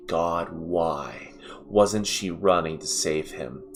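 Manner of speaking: ordinary delivery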